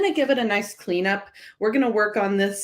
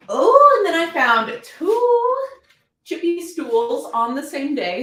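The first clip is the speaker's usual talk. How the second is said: thrilled, much higher and louder on Oh, slowing down; can't wait to show you this; excited; 10 semitones higher, 6 dB louder, slower than usual. Real, not acted. Thrilled to show new finds.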